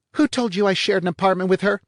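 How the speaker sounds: high, loud, fast sharp question; snapping. A narrator's reading. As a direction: snap it at him. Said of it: "the blonde snapped".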